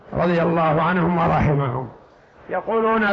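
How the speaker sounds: ordinary talk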